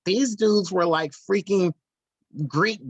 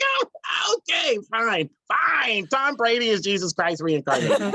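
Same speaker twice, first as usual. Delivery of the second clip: laughing mock shout, much higher, giving up; mock exasperated surrender; 6 semitones higher than usual. Mocking giving in to an excited cohost, laughing mock shout. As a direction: throw up your hands and cave, hammed-up. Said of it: "And I'm just like".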